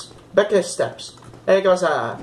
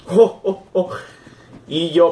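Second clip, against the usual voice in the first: normal talking voice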